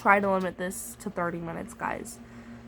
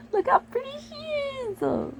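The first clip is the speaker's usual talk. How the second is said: high cooing gush; slower than usual. Real, not acted. Gushing at a pretty picture, cooing high and slow.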